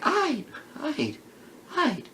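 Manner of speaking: soft high grudging okay. Retold or imitated. Woman voiced, soft high.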